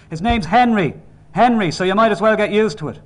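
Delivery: high, loud, insistent declaration; furious father settling the argument; angry shout. A narrator's reading. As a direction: shout the name down at them. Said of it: "He had to shout".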